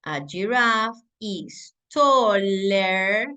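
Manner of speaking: slow, deliberate English example; teacher modelling a sentence slowly; slow model sentence. Shown as a demonstration. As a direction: spell it out slowly for the class. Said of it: "voy a decir".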